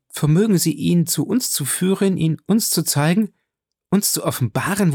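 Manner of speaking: high-pitched, imploring questions; urgent pleading. A narrator's reading. Julie implores the count to bring the man, pleading. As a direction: plead eagerly, voice lifted high.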